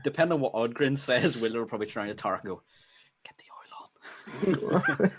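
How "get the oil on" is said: slow, voiceless whisper with a laugh; whispered aside. Acted. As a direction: whisper the order jokingly. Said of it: "for people at home didn't hear that".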